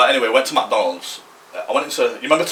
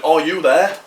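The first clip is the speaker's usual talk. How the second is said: mock calling over; 7 semitones higher, 6 dB louder, slower than usual. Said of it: "like, shouting over to all lads".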